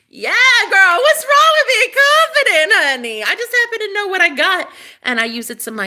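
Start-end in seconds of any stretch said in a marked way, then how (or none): 0.1-4.7 flaunt it like a diva; sassy diva. Acted. Diva flaunting her confidence to the viewers, high and sassy.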